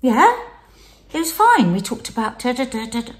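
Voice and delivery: imitating husband. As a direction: lightly mimic his casual report. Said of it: "And he went".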